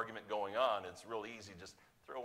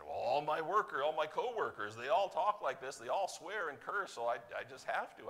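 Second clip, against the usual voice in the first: higher, louder mock excuse of a churchgoer; excuse-making churchgoer; 7 semitones higher, 7 dB louder than usual. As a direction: imitate someone making excuses for swearing. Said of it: "They said".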